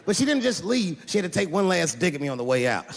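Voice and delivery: ordinary talk, nothing marked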